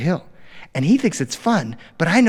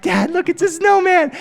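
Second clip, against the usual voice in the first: put-on high little-kid voice showing off; proud two-year-old calling to dad; high eager child voice; excited toddler; 4 dB louder than usual. Toddler son voiced in story.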